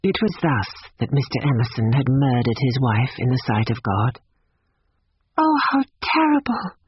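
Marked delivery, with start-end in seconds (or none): 5.4-6.8 high-pitched, shocked exclamation; horrified young woman. A narrator's reading. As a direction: react with appalled sympathy in a girl's high voice. Lucy shocked at the story.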